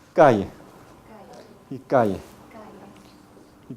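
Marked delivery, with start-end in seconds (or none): 0.1-2.2 "calle" as "ca-ye", low and falling; y glide for the ll in "calle"; Latin American ll. Shown as a demonstration.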